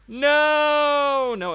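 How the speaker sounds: sudden high yell of dismay; shocked shout. Real, not acted. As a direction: blurt out a loud no. Bad gacha pull, screams.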